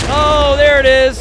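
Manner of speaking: loud, high burst of excitement; big play call; excited. Real, not acted. Caster sees the big attack arrive.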